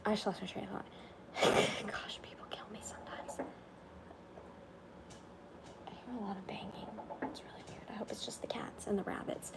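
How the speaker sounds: hushed, voiceless aside; softly whispered worry about noises outside. Real, not acted. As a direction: whisper so nothing is disturbed. Whispering while hearing banging.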